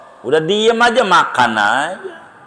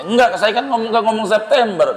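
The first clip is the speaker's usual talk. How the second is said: mock retort; 9 semitones higher, faster than usual. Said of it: "Kapan saya ngomong".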